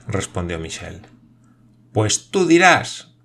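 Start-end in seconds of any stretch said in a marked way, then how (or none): 1.9-3.2 much higher, louder, slow roar; shouted. A narrator's reading. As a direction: roar it, losing control. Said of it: "le gritó Nicol ... rugidos de su voz". (grito lento, agudo y fuerte)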